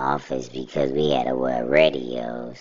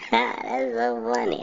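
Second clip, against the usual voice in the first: laughing. Real, not acted. Laughing at the memory.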